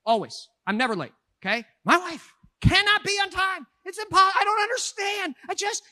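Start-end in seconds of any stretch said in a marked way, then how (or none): 1.8-5.8 loud, exasperated complaint; exasperated outburst. Real, not acted. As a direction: throw up your hands about the lateness. Exasperated rant about a late wife.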